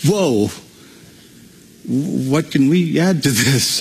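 slow, amazed whoa before the question; marvel, lost for words; awed wonder. Shown as a demonstration. Preacher shows the right reading, in slow awe. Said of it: "He's saying it like this".

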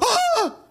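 high, loud mock gasp; sudden high "Ah!", hammed up; startled gasp. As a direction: imitate a sudden shriek of alarm. Imitating wife's startled gasp.

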